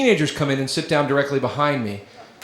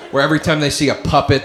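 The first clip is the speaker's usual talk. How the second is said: usual speaking voice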